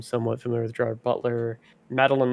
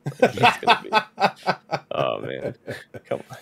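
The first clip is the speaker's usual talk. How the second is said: Vin Diesel; slower than usual. Acted. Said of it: "Vin Diesel doesn't just grunt".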